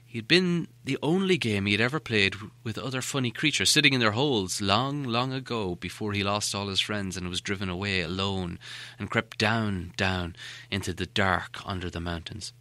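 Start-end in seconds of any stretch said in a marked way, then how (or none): none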